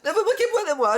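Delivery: put-on high girly character voice; high laughing Félicia voice. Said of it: "je suis en mode Félicia".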